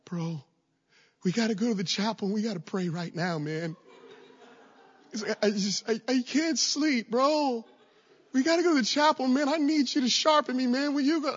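begging your friend to go pray now; dramatic roommate. Retold or imitated. Roommate begging the narrator at 2 AM, high dramatic plea. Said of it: "he gets really, really dramatic".

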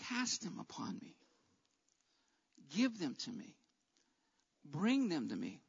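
soft, low, pausing reassurance; gentle invitation. Retold or imitated. Preacher voicing the Lord to the burdened, soft and tender. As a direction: offer each plea softly, letting it settle.

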